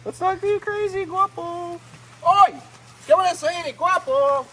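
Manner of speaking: calling the croc. Real, not acted. Calling at crocodile Guapo.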